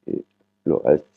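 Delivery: ordinary talk, nothing marked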